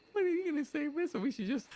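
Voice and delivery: very high joking voice; squeaky put-on voice. To his friends, still in the high robber voice. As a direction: keep the squeak going while chatting.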